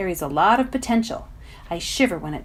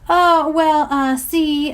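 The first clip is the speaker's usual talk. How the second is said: nervously reasonable; 7 semitones higher, 7 dB louder, much slower than usual. Acted. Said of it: "trying to sound reasonable and not terrified".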